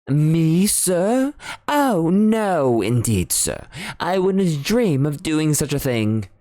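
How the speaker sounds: indignant butler. Acted. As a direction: deny it with prim dismay. Parker denies calling.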